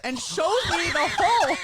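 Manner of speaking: high, slow, laughing shout. Real, not acted. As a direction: blurt it out through laughter. Laughing while retelling.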